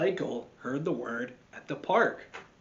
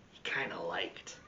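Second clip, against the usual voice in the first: whispered confession; 9 semitones lower, 10 dB quieter than usual. A narrator's reading. Narrator whispers that Michael liked it. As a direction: lower unvoiced whisper.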